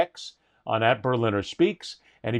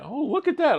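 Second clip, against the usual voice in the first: delighted; 12 semitones higher than usual. Retold or imitated. Imitating delighted actor.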